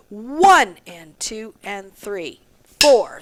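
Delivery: slow count, punch "One", back off, then punch "Four"; accents on one and four. Shown as a demonstration. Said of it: "the one and the four should be louder".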